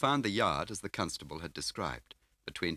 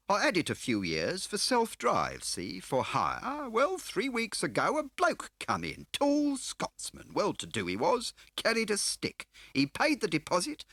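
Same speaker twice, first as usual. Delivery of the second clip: smarmy dealer playing grand; oily fat car dealer; 6 dB louder than usual. A narrator's reading. Scar's voice held.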